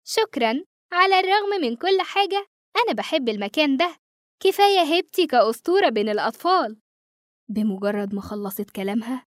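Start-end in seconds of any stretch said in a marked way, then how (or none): 0.1-6.8 put-on high, pleased ghost girl voice; smiling ghost girl. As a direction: smile through it, fond of the place. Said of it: "ابتسمت وهي بتقول".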